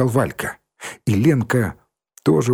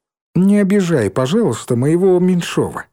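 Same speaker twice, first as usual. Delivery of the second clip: higher, louder mock warning; mock threat; 7 semitones higher, 3 dB louder than usual. A narrator's reading. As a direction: tease him with a menacing politeness. (шутливая угроза)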